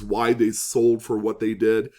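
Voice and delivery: ordinary talk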